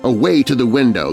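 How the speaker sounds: usual speaking voice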